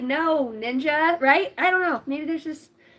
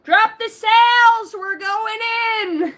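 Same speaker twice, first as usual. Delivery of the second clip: slow, high, loud captain's order; mock sailor shout; 10 semitones higher, 7 dB louder, much slower than usual. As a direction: bellow it like a ship's captain.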